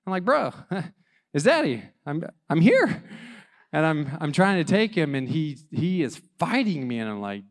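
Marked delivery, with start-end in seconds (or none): 0.2-3.0 retold pleading, higher and a bit louder; mock-exasperated appeal to a screaming toddler; exasperated dad. Exasperated dad reasoning with screaming son.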